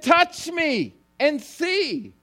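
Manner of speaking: high, slow "touch me and see"; urging Jesus. Acted. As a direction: coax them to reach out, slowly. Jesus invites the disciples.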